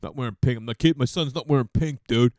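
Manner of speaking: loud, macho bro voice mocking dudes. Mocking dudes who refuse to wear pink, as a loud bro. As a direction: ham up a dismissive bro who rises to a shout. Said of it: "how dudes are like".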